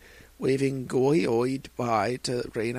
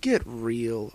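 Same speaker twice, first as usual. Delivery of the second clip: usual speaking voice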